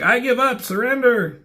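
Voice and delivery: hammed-up alien begging for mercy; alien surrendering. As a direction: squeal out a desperate surrender. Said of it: "This guy's like".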